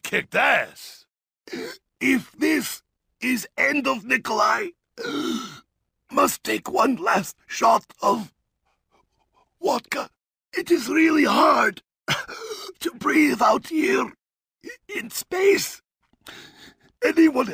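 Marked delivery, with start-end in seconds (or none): 5.0-5.5 sharp high gasp; gasp for air. Acted. Cosmonaut out of air on the moon, a sharp gasp. 12.1-12.8 sharp high gasp; gasp for air. Acted. Cosmonaut out of air on the moon, a sharp gasp.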